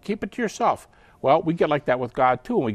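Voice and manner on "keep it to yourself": a little emotional. Acted. Snubbed caller gives up, huffy and higher-pitched. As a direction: toss it off in mock offence. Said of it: "you get a little emotional".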